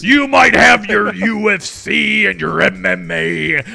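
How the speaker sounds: wrestling voice. Pretend. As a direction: taunt like a pro wrestler. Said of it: "That is just a superb wrestling voice".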